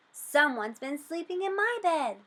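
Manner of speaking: ordinary delivery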